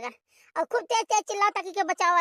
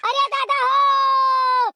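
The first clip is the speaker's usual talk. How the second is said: calling for help; 7 semitones higher, slower than usual. Acted. Calling for help, fleeing wolf.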